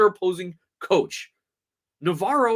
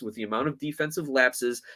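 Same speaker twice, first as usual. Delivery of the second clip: ordinary talk, nothing marked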